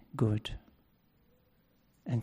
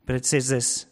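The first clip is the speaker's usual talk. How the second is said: usual speaking voice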